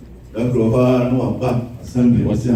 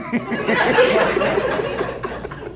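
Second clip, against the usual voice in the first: high laugh; 5 dB quieter than usual. Real, not acted. Laugh after the joke.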